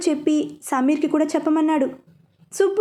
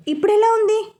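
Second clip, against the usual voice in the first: higher, slightly worried question; lightly anxious voice; a bit anxious; 6 semitones higher than usual. A narrator's reading. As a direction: ask with a touch of nervous concern. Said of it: "కూసింత కంగారుగా".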